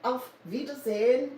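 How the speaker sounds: German accent, lifting on Wiedersehen; a careful German-accented farewell; German accent, rising at the end. Shown as a demonstration. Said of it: "try to talk with a good German accent".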